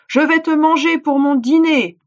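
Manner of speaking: high, loud monster roar; terrible djinn roaring a threat. Acted. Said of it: "rugit-il".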